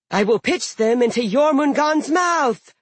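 high, loud eager giantess; a bloodthirsty sea giantess volunteering. Acted. Daughter's threat.

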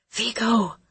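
breathless call of a name; panting. A narrator's reading. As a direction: gasp the name out between pants. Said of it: "I panted".

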